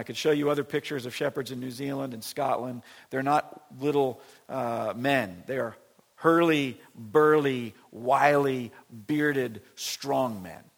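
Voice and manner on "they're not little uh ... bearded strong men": loud, punchy preaching list; preaching at full force. Acted. Preacher to congregation, loud and emphatic. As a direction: hammer each word home to the congregation.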